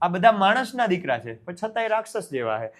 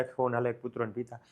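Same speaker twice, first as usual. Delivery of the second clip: thinking aloud; 6 semitones lower, 9 dB quieter than usual. Acted. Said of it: "પછી વિચારે".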